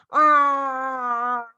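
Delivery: loud wide-open "ah"; lion roar. Shown as a demonstration. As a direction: roar with the whole face. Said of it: "so lion".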